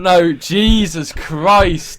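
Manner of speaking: loud and high in disbelief; exasperated outburst. Real, not acted.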